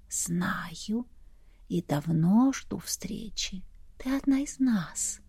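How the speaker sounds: breathy whisper like wind; gusting windy whisper. A narrator's reading. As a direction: whisper it like a breeze. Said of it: "будто ветерок шелестел в ушах". (шёпот, как шелест ветра)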